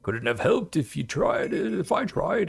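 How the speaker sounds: put-on high, quavering old grandpa voice; very old man. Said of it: "I should do a grandpa voice".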